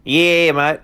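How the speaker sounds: drawled Australian "mate"; broad Australian twang. Shown as a demonstration. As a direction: lay the Australian twang on thick. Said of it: "the twang of the Australian accent".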